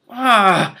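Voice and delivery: startled cry. Acted. Killer cries out.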